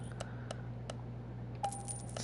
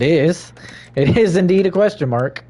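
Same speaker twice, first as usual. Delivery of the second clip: mock proclamation; 7 semitones higher, 6 dB louder than usual. Mock grand declaration.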